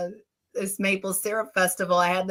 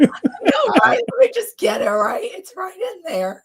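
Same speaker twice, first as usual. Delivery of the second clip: laughing squeak; 11 semitones higher, 4 dB louder than usual. Real, not acted. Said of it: "get the squeaker out".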